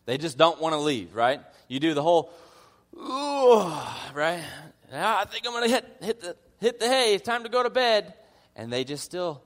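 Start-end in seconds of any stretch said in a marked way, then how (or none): 2.9-3.7 high, loud fake stretch sound; a hammed-up hint that it's late; mock yawn. Host faking tiredness at a party, hammed-up yawn. 4.9-8.1 high, loud mock-sleepy hint; hinting host. Host to guests who won't leave, high mock-sleepy hint.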